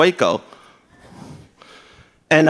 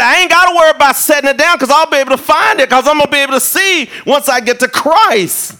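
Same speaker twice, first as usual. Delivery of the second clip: higher and louder, rushing the words; excited; 9 semitones higher, 4 dB louder than usual. Retold or imitated. Blind Bartimaeus voiced rushing with eager hope, higher and louder. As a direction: blurt it out full of eager hope.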